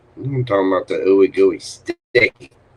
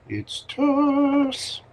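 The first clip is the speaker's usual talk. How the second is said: drawn-out s like a snake. Acted. Greeting the caller by name.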